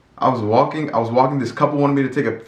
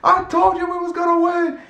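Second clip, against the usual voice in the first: taunting fan. Retold or imitated. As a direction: gloat at Matt in a high put-on voice. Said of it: "screaming at him".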